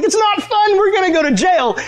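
very high, frantic protest; comic panic. Retold or imitated. Cautious friend warning the prankster of jail, panicked. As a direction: act out panicking at a friend who finds it funny.

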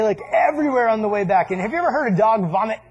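ordinary delivery

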